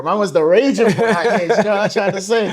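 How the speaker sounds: loud, high, through laughter; real laughing exclamation; amused teasing. Real, not acted. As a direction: crack up while hyping up your friend. Teasing friend about his past, laughing.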